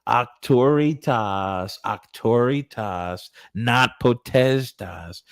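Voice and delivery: mock taunting chant, slow and drawn out; slow needling singsong imitation; poking and prodding. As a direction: prod them mockingly, stretching each Latin word. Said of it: "poking and prodding".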